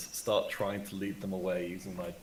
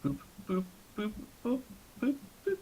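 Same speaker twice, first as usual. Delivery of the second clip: little high boops, climbing in pitch; rising boops; 12 semitones higher, 6 dB quieter, faster than usual. Pretend. Player moving a piece square by square, high rising boops. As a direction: count steps in playful, ever higher boops.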